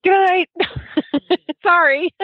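giggly goodnight. Real, not acted. Giggly high goodbye.

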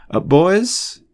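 high, lilting call-back; questioning call, pitched well up; calling after. A narrator's reading. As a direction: call them back mildly. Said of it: "Remus called after them".

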